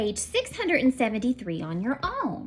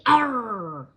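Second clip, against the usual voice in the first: pirate arr; 5 dB louder than usual. Shown as a demonstration. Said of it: "And I want you to say".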